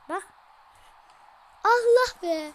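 loud, high exclamation; gamer's thrilled outburst at a great move; excited shout. Real, not acted. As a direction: yell in delight at the screen. Gamer reacting to a great play.

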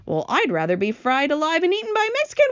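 high, fast, rising wail. A narrator's reading. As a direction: wail frantically as the grasshopper. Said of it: "wailed the old green grasshopper".

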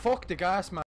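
loud frustrated curse; angry outburst. Real, not acted. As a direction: yell it at the gas. Angry at gas in game.

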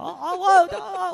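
high exaggerated oh oh oh; hammed-up echo of his booming reply. Mimicking a loud-voiced man.

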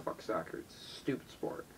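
whispered, dismissive grumble; muttered aside. Real, not acted. Spotting a soccer ball, muttered dismissal. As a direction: toss it off under your breath.

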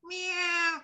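high, soft, drawn-out meow; cat meow. Shown as a demonstration. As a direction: imitate a cat in a small high voice. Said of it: "then we make the sound".

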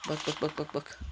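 hushed. Real, not acted. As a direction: barely breathe the words out. Tense moment waiting for enemy. (fısıltıya yakın, kısık sesle)

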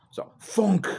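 sharp high thunk sound effect; axe thunk. Retold or imitated. The axe striking the stump, loud thunk. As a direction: punch out the chop noise. Axe chopping goose's head.

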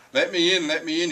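high, loud wolf demand; howling wolf. A narrator's reading. Hungry wolf at the door, howling to get in. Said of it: "he howled".

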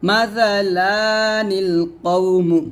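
মাসালানিল কওমু drawn out, one alif length on লা; slow recitation. Shown as a demonstration. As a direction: recite মাসালানিল কওমু slowly with noon qutni. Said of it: "এরকম লাতে আমরা এক লিপ টান দিব". (ধীরে টেনে তিলাওয়াত)

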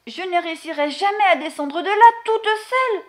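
a touch of a wailing hen; mild hammed-up lament. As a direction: moan it out, stuck up high. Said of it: "se lamenta la poule".

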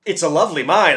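high, loud, hasty apology; apologetic. A narrator's reading. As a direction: blurt out a nervous apology. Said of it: "apologized the humbug".

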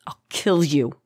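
mild choked gasp; a touch of strangled breath; gasped threat. A narrator's reading. Choked captive threatens her captor, mild gasp. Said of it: "gasped Karis".

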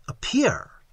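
high, startled one-word query; startled echo. A narrator's reading. Narrator catching her strange word, startled. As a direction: pitch it up in disbelief.

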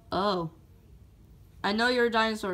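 ordinary delivery

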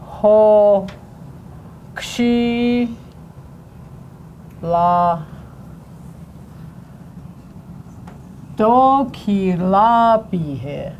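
each syllable said apart for learners. Shown as a demonstration. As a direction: spell the word out in sounds. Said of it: "See how it goes?".

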